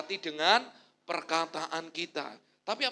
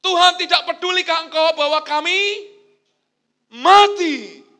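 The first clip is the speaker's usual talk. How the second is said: loud, high desperate plea; frantic shout to a sleeping master; panicked cry for help; 10 semitones higher, 18 dB louder, slower than usual. Acted. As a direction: cry out like men about to drown. Disciples cry out in storm.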